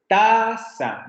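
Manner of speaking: "taza" with TA high and stretched, za dropping; stress on first syllable. Shown as a demonstration. Teacher showing syllable stress, "taza" as exaggerated TA-za. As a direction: punch and lengthen the TA of "taza". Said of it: "tiene el acento en la primera sílaba".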